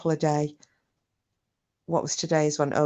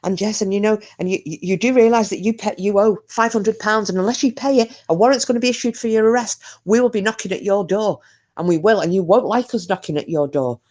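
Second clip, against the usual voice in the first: higher, pressing, rushed caller; frantic scam caller; 6 semitones higher, 3 dB louder than usual. Retold or imitated. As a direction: hurry the threats like a frantic scammer. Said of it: "they get really frantic when they're talking to you".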